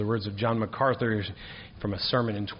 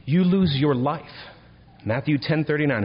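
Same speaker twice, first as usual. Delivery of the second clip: high, loud, ringing preacher's cadence; preaching at full force; 5 semitones higher, 6 dB louder than usual. Acted. Pastor exhorting his congregation about David, full force. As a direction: proclaim it to the back pews. Proclaiming scripture.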